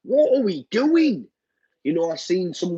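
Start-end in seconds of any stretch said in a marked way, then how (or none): 0.0-1.3 high-pitched exasperated replay of despair; frustrated venting. Retold or imitated. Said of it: "It's so frustrating".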